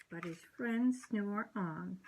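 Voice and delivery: usual speaking voice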